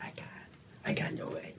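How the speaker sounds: pleading whisper with no voice left; voiceless whisper. Retold or imitated. Voiceless friend refusing to do the set, whispering. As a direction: push the words out on air only. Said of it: "and he was like, lost voice".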